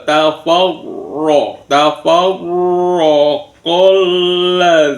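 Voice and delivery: tafawur with a rolled, full-mouthed r; thick, heavy r. Shown as a demonstration. Teacher showing tafkhim, tafawur with thick held r. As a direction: chant tafawur slowly, the r heavy and doubled. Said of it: "tafkhim, tebal".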